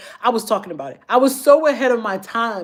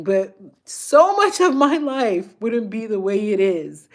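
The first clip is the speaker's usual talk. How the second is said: usual speaking voice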